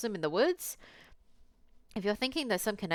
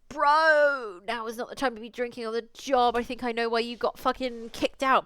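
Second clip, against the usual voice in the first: loud, higher scolding; scolding Marcus; 7 semitones higher, 7 dB louder than usual. Acted. Player scolding Marcus for drinking on the job, loud and exasperated. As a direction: tell him off, loud and exasperated.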